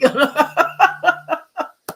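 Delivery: high, loud happy laughter; big gleeful cackle; delighted laugh. Real, not acted. Laughing after cheering.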